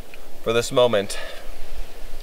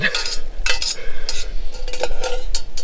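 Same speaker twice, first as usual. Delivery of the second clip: breathy laugh. Real, not acted. Laughing while cooking.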